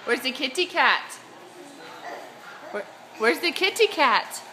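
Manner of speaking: normal talking voice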